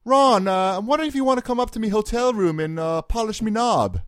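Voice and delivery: high, fast hammed-up Cockney-ish British accent; Peter Moore impression. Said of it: "pretending I was Peter Moore".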